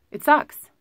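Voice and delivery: high, loud exasperated repeat; emphatic repeat. Real, not acted.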